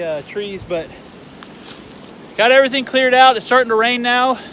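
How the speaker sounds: loud and high over the wind; shouting over wind. Real, not acted. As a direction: yell to be heard in a storm. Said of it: "Sorry I'm having to scream".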